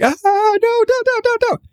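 put-on very high, pleading squeal of a panicking kid; panicked child. Scared son begging mom not to push his tooth, high squeal. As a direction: mimic a frightened seven-year-old begging her to stop. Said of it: "he starts freaking out and shaking his head and like".

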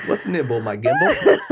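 laughing, loud and high; laughing shout. Real, not acted. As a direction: burst out laughing through it. Laughing repeat of catchphrase.

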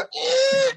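very high, long wheel squeak; high imitation creak; imitated unoiled wheel squeak. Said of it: "and it's not oiled".

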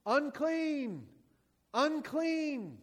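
high, loud call of unclean; a leper calling out a warning; leper's warning cry. Retold or imitated. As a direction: cry it out to keep people away. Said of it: "something you had to yell out".

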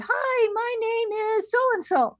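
put-on high girly voice, slowly; mocking female voice. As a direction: imitate a man making fun of women's voices. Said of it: "don't do a stereotypical female voice".

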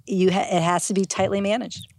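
ordinary talk

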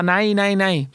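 high, dismissive triple no in a put-on voice; Wahlbeck impression. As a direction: pitch up and brush off the newcomer. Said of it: "och han bara". (hög, avfärdande Wahlbeck-röst)

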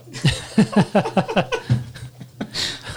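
high, breathless burst of giggling; stifled giggles. Real, not acted. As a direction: giggle you can't hold back. Giggling fit mid explanation.

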